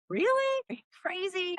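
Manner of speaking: high, squeaky incredulous mock retort; incredulous disbelief. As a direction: fling it back like she's lost her mind. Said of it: "And then I'm like".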